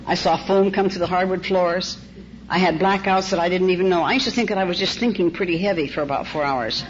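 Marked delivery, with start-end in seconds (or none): none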